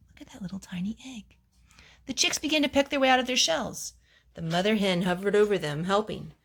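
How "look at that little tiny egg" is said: whisper. A narrator's reading. Reader points out tiny egg.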